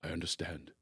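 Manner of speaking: low, soft, compassionate; quietly understanding, one who has been there too; mild compassion. Retold or imitated. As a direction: Robin Williams comforting a hung-up son, softly. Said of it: "Just goes".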